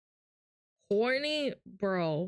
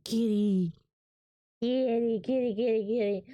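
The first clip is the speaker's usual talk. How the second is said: calling the cat; 2 semitones lower, 3 dB louder than usual. Real, not acted.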